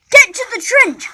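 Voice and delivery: big shouted command; shouted order. A narrator's reading. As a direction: yell it like a drill sergeant. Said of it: "shouted the drill sergeant".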